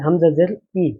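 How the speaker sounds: ordinary delivery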